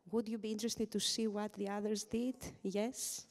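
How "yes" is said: ordinary talk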